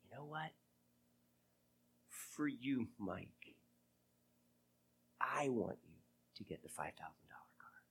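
barely above a whisper, gentle; God speaking softly to Mike; still small voice. Retold or imitated. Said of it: "that still small voice".

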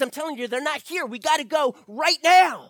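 urgent shout. Acted. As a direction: scream at them to run now. Lenz insists they must flee.

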